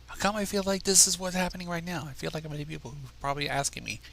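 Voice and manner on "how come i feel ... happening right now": plain reading voice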